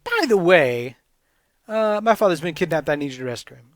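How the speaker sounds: high, loud, chirpy princess voice; breezy princess. Acted. Princess greeting Nemo, loud and chirpy. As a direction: drop the bad news breezily, bright and high. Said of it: "you're like".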